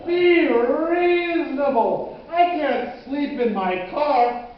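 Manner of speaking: loud, high, drawn-out protest; exasperated plea. Acted. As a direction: plead loudly, stretching each word. Exasperated pleading with old man.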